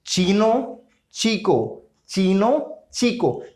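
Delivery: चीनो and चीको with a clear ch sound; Spanish ch examples. Shown as a demonstration. Teacher gives ch example words, clearly. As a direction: chant the words out.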